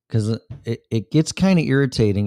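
ordinary delivery

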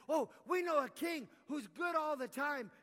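high, loud, slow excited announcement; eager exclaim. Acted. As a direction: burst out with the good news. Advisor announcing eagerly to king.

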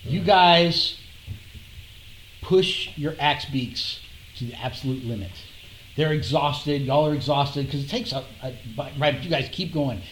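low, dramatic scene setting; game master narration. Acted. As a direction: paint the scene slowly, ominously.

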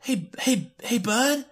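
talking back to David on screen, high needling scold; scolding hey bud. Retold or imitated. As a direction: jab at him like a heckler. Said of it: "It's like".

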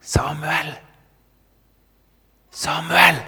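whisper-call insistently to wake the sleeper; urgent, breath-heavy calling; stage-whispered call. Acted. The voice calls a third time.

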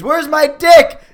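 high, loud alarmed yell; alarmed shout. Acted. Voicing the snowman looking down.

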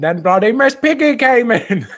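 Cockney Caine story voice, high and loud at the end; laughing London accent Caine anecdote; Michael Caine impression. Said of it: "now your Michael Caine impression".

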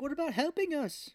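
lightly squeaked, put-on plea; mock Korra voice. Recapper voicing heroine asking for help, high mock plea.

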